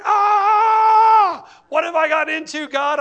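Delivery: high, loud alarmed cry to God; panicked shout. Retold or imitated. New houseparent cries out to God, mock panicked shout. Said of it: "I'm like".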